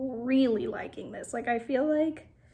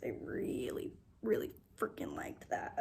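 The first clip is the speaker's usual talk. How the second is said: whisper; 12 semitones lower, 7 dB quieter than usual. Real, not acted. Whispered thrill.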